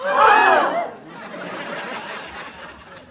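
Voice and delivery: high airy shocked gasp; big breathy gasp; crowd gasp. Acted. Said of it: "they all shocked".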